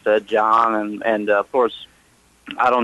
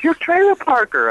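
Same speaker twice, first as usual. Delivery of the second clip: excited fan. Retold or imitated. Fan lady shouting his nickname in Walmart, high mock squeal. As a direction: play the fan lady recognizing him, much higher.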